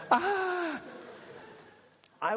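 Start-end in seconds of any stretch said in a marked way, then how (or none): 0.1-0.8 high laughing cry of frustration; mock shriek, half laughing; frustrated yelp. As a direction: speaker retelling his own frustration, a high laughing yelp. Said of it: "I was like".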